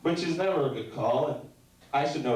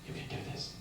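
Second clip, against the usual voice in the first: whispered, breathy pep talk to himself; self pep talk whisper; 13 dB quieter, faster than usual. Pretend. Comic psyching himself up in a whisper before going on. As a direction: coach yourself under your breath. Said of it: "Here's one of myself".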